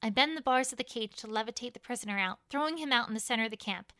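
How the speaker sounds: plain reading voice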